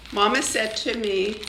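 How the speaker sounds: usual speaking voice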